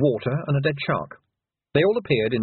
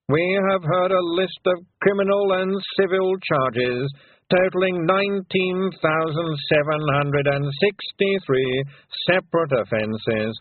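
dry, slower formal reading; the lawyer; 7 semitones higher than usual. A narrator's reading. Lawyer reading charges to the Feegles, dry and formal. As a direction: recite the charges officially.

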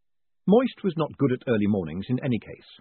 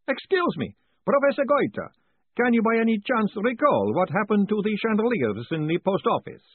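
put-on high, lively old professor; chatty academic explaining cheerfully; professor's voice; 10 semitones higher, 4 dB louder than usual. A narrator's reading. Professor chats to Moist, high and lively.

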